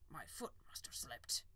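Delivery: sheepish whisper; whispered excuse. Acted. Farmer to the unicorn, sheepish whisper. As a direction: breathe the excuse out. Fat man excuses himself.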